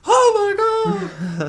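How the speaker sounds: very high, drawn-out loud wail; horrified mock scream. Driver sees the cyclist on the ground, imitated horrified scream. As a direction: shriek it slowly like the panicked driver, hammed up.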